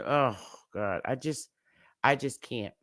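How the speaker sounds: real distress, voice failing; breaking down. Real, not acted. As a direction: give up mid-sentence, overwhelmed. Breaking down, can't go on.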